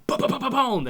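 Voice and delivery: loud, high burst of booms; imitated gunfire. Player retelling Deadeye on four guys, loud imitated gunfire. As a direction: rattle off mock shots, ending big.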